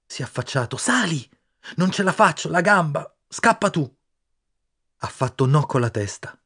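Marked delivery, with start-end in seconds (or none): 0.8-4.0 higher, pained urgent shouting; injured boy begging his friend to escape; desperate plea. Acted. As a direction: beg him to run. Injured boy urging friend away.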